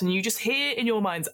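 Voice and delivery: normal talking voice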